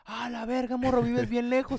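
high-pitched, drawn-out amazement; mock astonishment. Stranger learns how far away the lost kid lives, mock astonishment.

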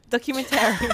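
laughing take. Shown as a demonstration. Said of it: "Take two".

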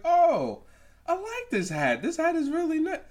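high-pitched gushing mom impression; imitated gift-opening delight; mock delight. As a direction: coo over a present in a very high voice.

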